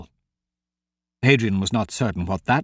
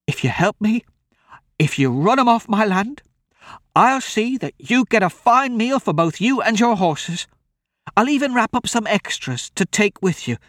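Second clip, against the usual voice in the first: put-on high-pitched, eager old country woman; pleading old woman; 10 semitones higher than usual. Old woman offers a meal for help, pleading.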